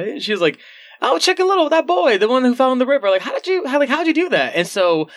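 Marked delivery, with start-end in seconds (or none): 1.0-4.6 high-pitched imitation of a pressing accuser; retold accusation, pitched way up; mock insistent questioning. Acts out Eva pressing Nell.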